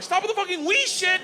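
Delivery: high, exasperated mock shout; exasperated yell. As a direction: snap at Igor to stop. Speaker yelling at Igor.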